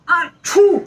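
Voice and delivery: a loud mock achoo; loud sneeze. Shown as a demonstration. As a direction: demonstrate a big sneeze. Teacher demonstrates sneezing.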